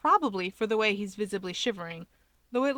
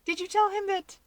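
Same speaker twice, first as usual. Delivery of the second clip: teasing sister; 11 semitones higher than usual. A narrator's reading. Sister voiced, playful question.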